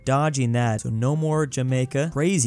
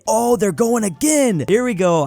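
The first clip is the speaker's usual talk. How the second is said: high, loud cry of real excitement; excited outburst; 8 semitones higher, 5 dB louder than usual. Real, not acted. Game player thrilled they are going again, excited. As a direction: burst out higher and louder, thrilled. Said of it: "I don't know why I'm so excited".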